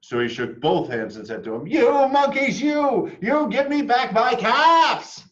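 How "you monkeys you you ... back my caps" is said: much higher, loud, slow angry cry; furious peddler yelling; angry demand. A narrator's reading. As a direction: shake both hands and demand them back. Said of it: "This made the peddler angry".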